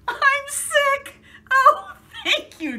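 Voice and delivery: high, loud, relieved near-cry; a high, loud burst of joyful relief; overjoyed. Acted. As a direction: gush your thanks to the doctor. Gerald overjoyed at diagnosis.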